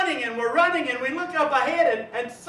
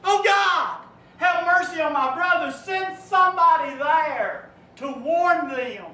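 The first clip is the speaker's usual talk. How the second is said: screaming out; 3 semitones higher, 4 dB louder than usual. Acted. Rich man in hell begging God for water, screaming. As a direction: scream for just one drop of water. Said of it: "that voice screams out".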